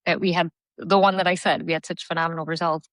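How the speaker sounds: normal talking voice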